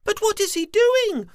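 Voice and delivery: very high squeaky little worm; small worm voice. Acted. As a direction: ask like a tiny curious worm.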